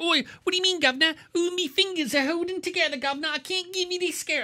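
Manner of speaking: high, hammed-up Cockney bumbler; mocking Cockney accent. Mocking the actor ad-libbing with Ethan Hawke, mock Cockney. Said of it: "he's like".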